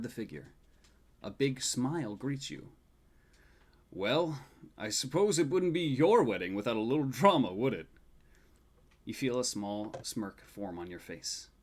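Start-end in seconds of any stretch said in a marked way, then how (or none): 3.9-7.9 a hint of a teasing old grandfather, louder; lightly voiced grandpa, ribbing gently; grandfather's voice. Grandfather teasing his grandson before the wedding, lightly voiced and a bit louder.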